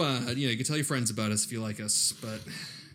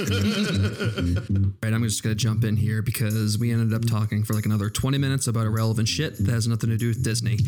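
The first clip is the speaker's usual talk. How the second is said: podcast outro read; 4 semitones lower, 5 dB louder than usual. Acted. Inserted outro over music.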